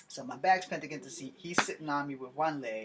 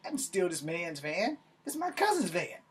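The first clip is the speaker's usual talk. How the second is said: indignant protest; 3 semitones higher than usual. Retold or imitated. Driver denying to the cop he stole the van, indignant protest. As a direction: voice climbing to a high squeak.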